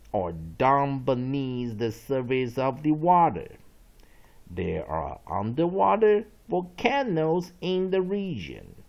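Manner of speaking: loud, slow dictionary reading; read-along definition. Shown as a demonstration. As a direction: read it out emphatically for students to follow. Reads definition and example aloud. (大声慢速朗读释义)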